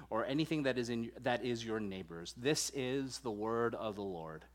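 measured, solemn reading of the Ten Commandments; steady liturgical reading voice; scripture reading. Acted. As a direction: read it out like the lesson in church. Formal scripture reading before the sermon.

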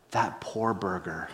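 low, slow, soft mock pity; mock compassion. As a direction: grieve gently for the burger, tongue in cheek. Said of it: "me being the compassionate, you know, counseling-oriented pastor".

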